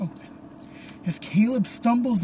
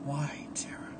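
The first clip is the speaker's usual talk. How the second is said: even softer, unvoiced whisper; menacing whisper; 3 semitones lower, 11 dB quieter than usual. Caleb pressing Tara for answers, a fainter whisper. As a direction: let the question hang in a hushed breath. Caleb repeats question, quieter.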